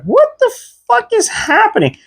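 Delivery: very high squeaky disbelief; retold stunned disbelief. Patient stunned by the nurse's harsh remark, very high squeaky disbelief. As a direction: squeak it out like you can't believe her. Nurse scolds him for crashing.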